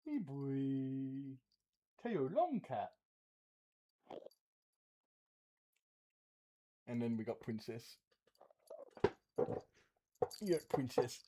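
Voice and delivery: soft, quiet cooing to the cats; pet talk. Real, not acted. Streamer cooing softly to her cats Teo and princess. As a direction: hush and coo like talking to a pet. Talking to the pets.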